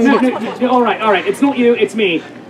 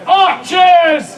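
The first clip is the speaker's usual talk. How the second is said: higher, drawn battle call; crying out; 4 semitones higher than usual. Acted. Antony summoning his archers, crying out. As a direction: shout the order to the troops. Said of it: "Anthony cries out".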